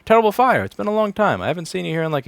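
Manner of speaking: high, loud happy welcome; excited greeting. Real, not acted. Greeting a returning viewer.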